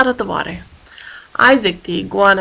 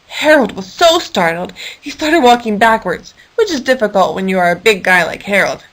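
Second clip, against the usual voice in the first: ordinary delivery, nothing marked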